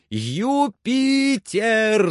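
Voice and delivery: very long, high holler; shouted call. A narrator's reading. Legrand hollers for his servant, long high shout. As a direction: stretch the name out to carry far. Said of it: "закричал он".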